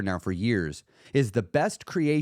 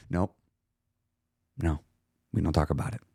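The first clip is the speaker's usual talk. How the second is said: firm calm self-talk; 7 semitones lower than usual. Pretend. Stern answer to voice.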